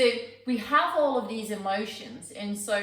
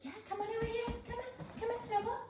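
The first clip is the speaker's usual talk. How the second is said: high, soft coaxing voice for a dog; pet talk; 13 semitones higher, 10 dB quieter than usual. Real, not acted. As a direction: coax the puppy over sweetly. Calling the dog over.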